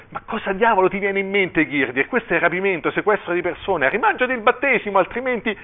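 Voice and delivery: high-pitched, mounting angry protest; indignant outburst. A narrator's reading. Outraged father confronting the bishop over the baptism, indignant.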